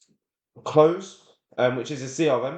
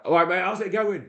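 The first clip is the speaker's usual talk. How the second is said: higher-pitched, cheery phone hello; lively, raised-pitch friendly greeting; upbeat, happy greeting; 8 semitones higher than usual. Shown as a demonstration. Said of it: "match their energy, okay? If they're happy".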